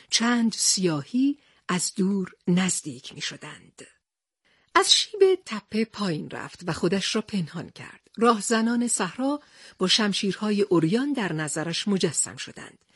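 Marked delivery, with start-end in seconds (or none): none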